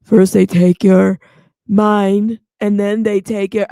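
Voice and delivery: very loud, slow mock baritone; slow, booming founder parody; Elizabeth Holmes voice. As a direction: boom it out slowly like a mannish CEO. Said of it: "I could do the voice".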